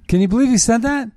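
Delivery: outraged disbelief at a senator's words; incredulous. Real, not acted. Disbelief at Romney quote.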